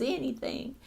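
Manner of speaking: plain reading voice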